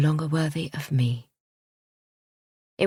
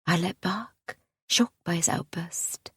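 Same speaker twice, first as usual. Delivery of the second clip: ordinary delivery, nothing marked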